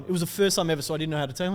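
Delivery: usual speaking voice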